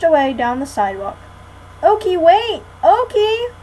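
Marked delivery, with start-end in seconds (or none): 1.8-3.5 loud, high desperate shout. A narrator's reading. Annie shouting after Okie running into the street. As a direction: yell after a dog running away. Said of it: "shouted Annie".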